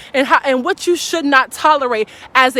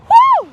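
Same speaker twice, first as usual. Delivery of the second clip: high whoop; 5 dB louder than usual. Real, not acted. Preacher's exclamation.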